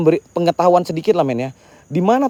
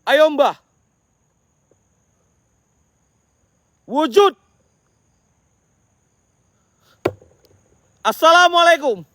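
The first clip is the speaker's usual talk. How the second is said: calling out; 11 semitones higher, slower than usual. Real, not acted. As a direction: challenge the spirit to show itself. Calling the spirit to appear.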